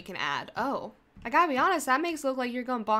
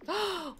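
sharp, high intake of breath; excited gasp; 8 semitones higher, 3 dB quieter than usual. Real, not acted. Player spots a pink she likes, excited gasp. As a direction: gasp at a sudden lovely find.

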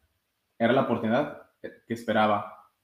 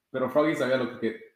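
ordinary delivery

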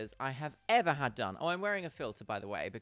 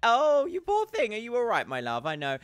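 ordinary delivery

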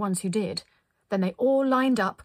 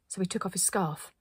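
ordinary delivery

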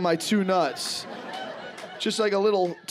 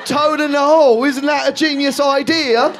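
high, prissy mock-posh voice, higher and slower at the end; pleased with their own clever name; mock British foodie; 9 semitones higher, 5 dB louder than usual. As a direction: lampoon them as smug food namers. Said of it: "they're like".

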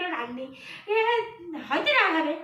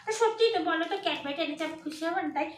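ordinary talk, nothing marked

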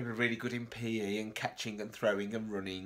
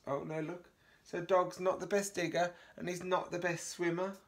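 ordinary delivery

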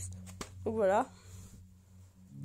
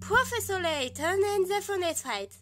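higher, mock English accent on Professor Layton and the Phoenix Wright; hammed-up English accent for the game title; 7 semitones higher than usual. Showing viewers her English accent, mock English accent. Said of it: "attention à l'accent".

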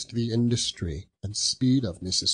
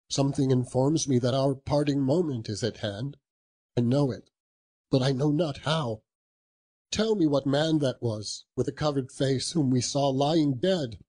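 higher, pressing question; urgent Scrooge; 6 semitones higher than usual. Acted. Scrooge presses the silent spirit, higher and urgent. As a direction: demand an answer from the ghost.

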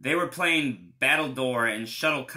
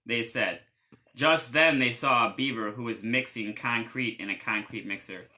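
plain reading voice

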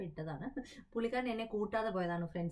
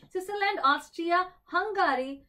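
higher and louder, calling out options; excited listing; 6 semitones higher, 6 dB louder, slower than usual. Real, not acted. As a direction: rattle off the countries with energy. Host reels off answer options.

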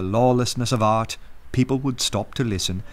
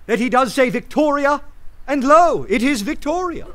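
rising triumph; 13 semitones higher than usual. Acted. Syme triumphantly rebuts Gregory.

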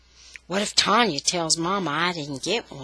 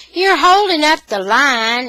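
raised, louder impatient complaint; impatient scolding; 7 semitones higher, 10 dB louder than usual. A narrator's reading. Child telling Tanya she's holding up the line, impatient. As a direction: scold her loudly for dawdling.

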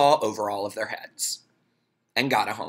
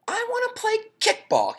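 put-on much higher little-boy voice; squeaky small kid pleading; high child voice asking to play; high kid voice for Ron. Ron voiced in read story.

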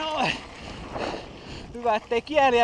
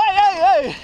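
alarmed no; 5 semitones higher, 7 dB louder, slower than usual. Real, not acted. Bike nearly crashes.

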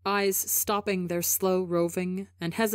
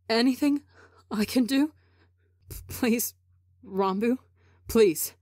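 halting, higher-pitched desperate pleading; tearful begging; 4 semitones higher than usual. A narrator's reading. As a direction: beg brokenly, stumbling between apologies. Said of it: "Tebo begs".

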